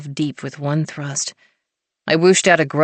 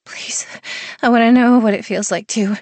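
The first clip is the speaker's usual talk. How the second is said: whining plea; 6 semitones higher, 3 dB louder than usual. A narrator's reading. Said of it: "I whined".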